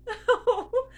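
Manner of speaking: high tearful laugh as emotion breaks through; a real choked-up giggle; teary laugh. Real, not acted. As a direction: laugh that tips toward crying. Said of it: "I'm starting to cry".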